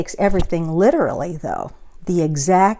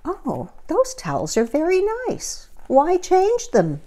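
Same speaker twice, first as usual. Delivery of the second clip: much higher, puzzled housekeeper voice; Amelia Bedelia musing; 10 semitones higher than usual. A narrator's reading. Amelia Bedelia puzzling over her list, much higher and puzzled. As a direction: wonder aloud why anyone would change nice towels. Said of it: "she thought".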